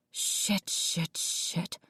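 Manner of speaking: unvoiced panicked whisper. A narrator's reading. Jessica cursing to herself, whispered panic. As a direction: hiss it under your breath in alarm. Jess panics under her breath.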